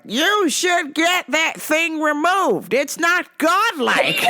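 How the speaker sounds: mock zealot lecturing a cashier; preachy Rome guy. Man demands tattoo removal.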